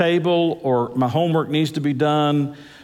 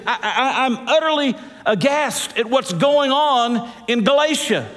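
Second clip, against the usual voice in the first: raised, pitched-up aghast preaching; voicing an appalled apostle from the pulpit; preaching outrage; 8 semitones higher than usual. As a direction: play Paul's indignation with a laugh.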